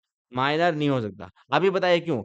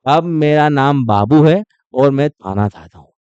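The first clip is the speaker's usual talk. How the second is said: louder, slow, playful lisp on थाना; punchline said big with a baby lisp; mock lisping boyfriend; 9 dB louder, slower than usual. Teacher jokes about a boyfriend turned baby-talker, loud and slow. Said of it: "फिर मेरी girlfriend बन गई".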